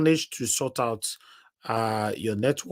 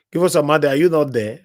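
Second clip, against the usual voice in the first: loud, higher call into silence; calling out loudly; 3 semitones higher, 7 dB louder, faster than usual. Real, not acted. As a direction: shout down the line for an answer. Host calling silent caller.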